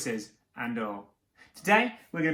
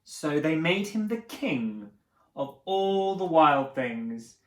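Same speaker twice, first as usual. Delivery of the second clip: usual speaking voice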